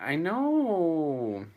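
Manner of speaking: high, drawn-out wail of sympathy; anguished agreement. Real, not acted. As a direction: cry out in pained agreement, stretching it. Agreeing over sad horse death scene.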